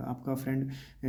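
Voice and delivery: ordinary delivery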